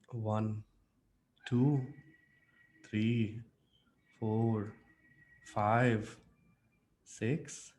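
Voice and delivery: low, soft, loving count; love expression. Shown as a demonstration. Coach demonstrating the love expression, loving counting. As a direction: murmur each number tenderly, leaving space between. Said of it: "what about the love now?".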